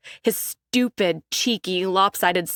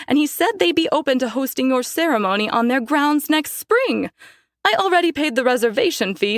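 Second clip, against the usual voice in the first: high, gushing, rapid mother; bubbling over with wedding news; excited; 6 semitones higher than usual. Acted. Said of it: "claps her hands together excitedly".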